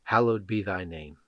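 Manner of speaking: leading listeners in the Lord's Prayer, hushed. Acted.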